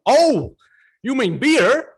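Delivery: high, loud "Oh, you mean beer?"; barman's sudden realisation. Retold or imitated. Barman to young Australian, realising in a high, loud voice. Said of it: "barman finally understands".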